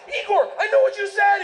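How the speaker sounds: high-pitched, thrilled announcement; excited learner. Acted. Eager student tells Russian friend he understood, excited. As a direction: blurt it out high with proud excitement.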